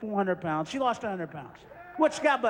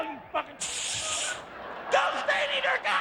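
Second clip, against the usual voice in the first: frenzied yell; 11 semitones higher, slower than usual. Acted. Acting out a frenzied attacker.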